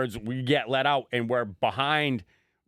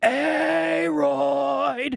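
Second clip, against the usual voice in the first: long, high drawn-out heckle; drunken yelling; 14 semitones higher than usual. Retold or imitated. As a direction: yell the name like a drunk fan, stretching it. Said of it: "I'm just drunkenly yelling".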